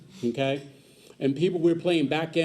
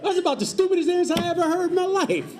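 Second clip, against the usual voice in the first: incredulous retort; 12 semitones higher, 3 dB louder, faster than usual. Retold or imitated. Retelling exasperated reply to bigot.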